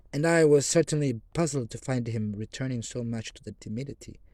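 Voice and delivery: ordinary delivery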